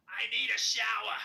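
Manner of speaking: very high, very loud and slow thick Boston; childhood Boston accent. Retold or imitated. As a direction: ham up the kid accent around the house. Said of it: "I spoke that way at nine".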